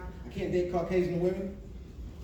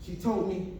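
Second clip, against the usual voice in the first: ordinary talk, nothing marked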